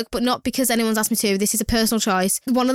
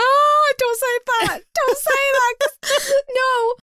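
high, slow teary wail; high teary plea, half laughing; mock crying; much slower than usual. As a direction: whimper it like you're about to cry. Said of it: "crying at that".

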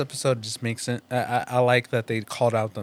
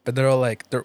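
ordinary talk

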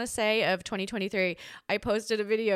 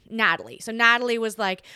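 normal talking voice

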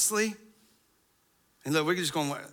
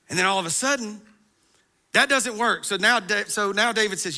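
louder, higher storytelling from the pulpit; fervent preaching; 6 semitones higher, 7 dB louder than usual. Acted. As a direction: drive the story forward with pulpit energy. Preaching resumes after aside.

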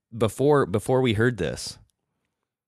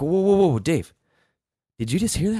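startled; 10 semitones higher, 4 dB louder, faster than usual. Retold or imitated. Alarmed at noise upstairs.

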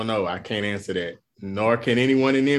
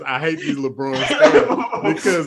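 laughing; 6 semitones higher, 4 dB louder, slower than usual. Real, not acted. Laughing while complaining.

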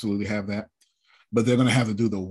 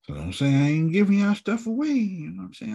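mock boast; 11 semitones higher than usual. Teasing the team, giving stuff.

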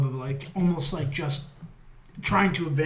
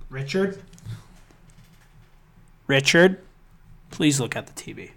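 mock pleading call; 4 dB louder than usual. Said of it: "I wish I could scream into the T V".

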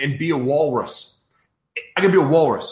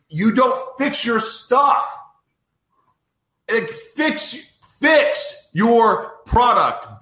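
exasperated plea; 6 semitones higher, much slower than usual. Real, not acted. Ranting at the software company.

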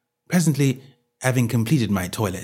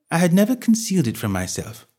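ordinary delivery

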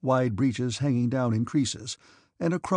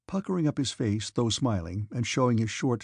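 plain reading voice

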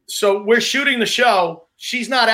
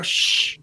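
imitated shushing. Host retelling how he hushed the crew, shushing. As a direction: shush the people backstage. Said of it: "just shush everybody".

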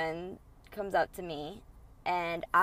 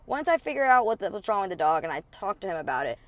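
ordinary talk, nothing marked

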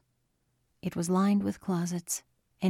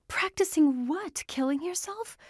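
high, frantic questioning; hysterical; 11 semitones higher than usual. A narrator's reading. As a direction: voice climbing in hysterical disbelief. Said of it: "Her voice sounded hysterical".

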